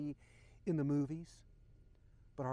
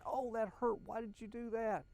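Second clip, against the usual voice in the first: higher-pitched, mild mock yell of pain; imitating someone wincing and crying out, not at full volume; retold pained cry; 7 semitones higher than usual. Wife in pain snapping at her helper, retold mild yell. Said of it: "wince and yell out".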